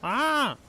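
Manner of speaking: sudden high yelp of fright; startled scream. Real, not acted. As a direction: shriek at a jump scare. Startled in game.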